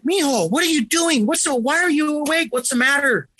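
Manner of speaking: worried mom checking on her son; mom's voice. Retold or imitated. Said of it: "my mom came in and she's like".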